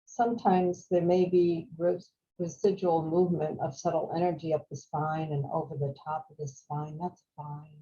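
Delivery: calm, unhurried meditation voice with long pauses; guided meditation. Real, not acted. Teacher guiding a group to follow the breath, calm meditation voice. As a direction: guide them gently through each breath, leaving space.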